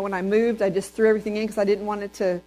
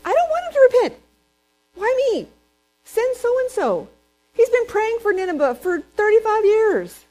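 whining Jonah; 13 semitones higher, 6 dB louder than usual. Retold or imitated. Jonah refusing his mission to God, much higher whine. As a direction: beg God to send someone else. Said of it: "he was sitting there saying".

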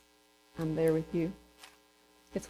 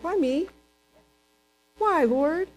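much higher, protesting; reluctant whine; 7 semitones higher, slower than usual. Retold or imitated. Believer questioning God's call, higher and whiny. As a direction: sulk at the task. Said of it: "and you're like".

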